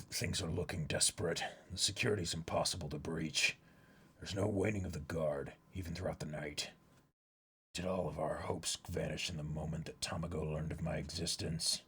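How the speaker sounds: a deep, hushed voice weighing a desperate plan; low, grave character voice. Acted. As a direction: speak low and grim about a hopeless mission. Reads a manga character aloud.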